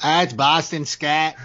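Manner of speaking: slow, hammed-up Boston accent; a deliberately bad Boston accent, drawn out. Joking to his co-host in a bad Boston accent. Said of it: "I can't do a good Boston accent".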